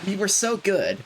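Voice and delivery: high, whining complaint; whiny lament. Real, not acted. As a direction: plead like it's unfair. Lament dog turned.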